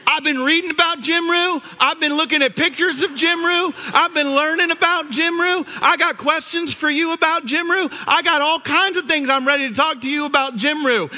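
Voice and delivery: high, eager rush of enthusiasm, lightly imitated; eager dad. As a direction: pile it on like a proud dad. Dad keeps gushing about Jimru.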